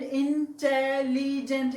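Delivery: "Intelligent" slowly in four separate parts; split into syllables. Shown as a demonstration. Teacher counting four syllables, "intelligent" broken into parts. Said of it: "इसके भी syllable जो है चार".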